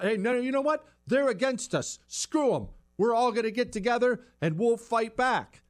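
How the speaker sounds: rally your side as if under threat; defiant rallying cry. Acted. Threatened group urging each other to fight back, defiant rallying.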